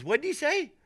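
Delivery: high-pitched questioning voice; imitating buddy's wife. Wife asking what her husband said, high imitation. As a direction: play the wife wanting to be filled in.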